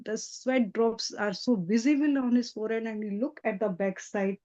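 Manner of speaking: usual speaking voice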